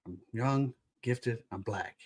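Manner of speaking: quiet affirmation. Pretend. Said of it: "Directly in the eyes".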